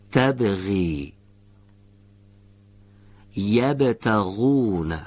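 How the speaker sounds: مغانم and یبتغون drawn out slowly, each غ a voiced, rasping throat fricative; مغانم, تبغی and یبتغون read very slowly with a clear voiced gargle; slow Quranic recitation. Shown as a demonstration.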